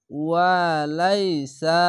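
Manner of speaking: very slow, drawn-out chanted recitation; Quran recitation. Shown as a demonstration. Teacher modelling the recited word for learners, slow and chanted. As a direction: stretch every vowel like a reciter. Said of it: "recites the word as a model".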